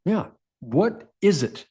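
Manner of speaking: ordinary talk, nothing marked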